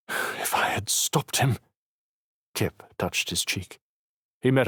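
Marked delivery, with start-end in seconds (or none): 0.1-1.6 slow, breathy guilty whisper; barely voiced, weighed down by guilt; whispered regret. Girl blaming herself to Kip, slow whisper.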